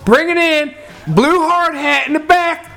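hollering the crew in, hammed up; mock yelling. Yelling at the whole job to gather in. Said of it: "I'm yelling this shit".

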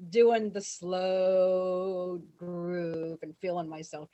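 long stretched vowels, languid; drawn-out groove. Retold or imitated. Storyteller showing she alone danced slow, drawn out. Acting out her slow dancing.

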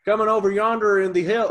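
loud, high Southern holler; hammed-up Southern hillbilly twang; Southern US accent. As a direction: call it out in a broad country accent. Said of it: "with that accent specifically".

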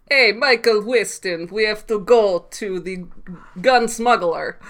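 mock thick Russian accent, slow. Podcaster mocking an actress's Russian accent, hammed-up Russian accent. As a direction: drawl it like a cartoon Russian spy. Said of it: "It's just a barely there accent".

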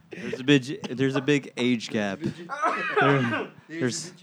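can barely get the words out for laughing. Real, not acted. Joking about the age gap between hosts, laughing hard.

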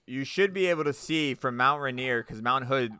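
ordinary talk

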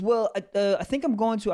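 mock caught-out hesitation; fumbling liar. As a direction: stall as if making it up on the spot. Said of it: "someone's fumbling or lying".

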